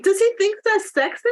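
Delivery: high, disbelieving question; can't believe this guy; incredulous. Retold or imitated. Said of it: "And I'm like".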